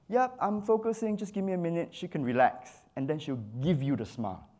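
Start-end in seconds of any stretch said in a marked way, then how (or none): 0.1-1.9 high-pitched, easy call to the model; photographer reassuring model. Acted. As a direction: keep the model posted, pitched up. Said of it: "So you say".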